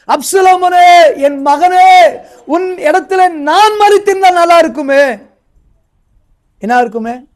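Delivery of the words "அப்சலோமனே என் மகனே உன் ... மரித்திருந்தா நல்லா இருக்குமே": grieving father's cry. Retold or imitated. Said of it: "சொல்றாரு".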